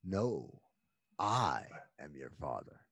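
very low, soft villain voice; villain's movie line. Retold or imitated. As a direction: drop deep and quiet for the reveal. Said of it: "the line is".

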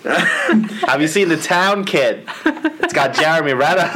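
high, slow, laughing hammed-up Boston accent; mock Boston accent. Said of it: "still just sounds like [name]".

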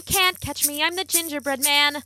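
high, bright cookie voice; cheeky little cookie; gingerbread man. A narrator's reading. Gingerbread man character line.

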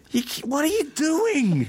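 high, slow, squealed outrage; a high, hammed-up jealous husband; shrill mock outrage. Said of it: "He was like".

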